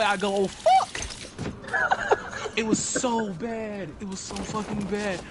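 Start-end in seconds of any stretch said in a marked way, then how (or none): 0.3-1.0 very high squeaked oh fuck; high cartoon squeal of a curse; squeaky curse. 1.0-2.5 airy high-pitched giggle; real laugh at the story. Real, not acted. Laughing at own squeak.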